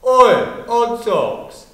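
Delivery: loud mock shout on "Oi"; retold shout. Bully calling to a child at the gate, imitated shout. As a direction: call out like a jeering bully. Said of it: "I heard someone shout".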